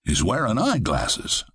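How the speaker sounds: usual speaking voice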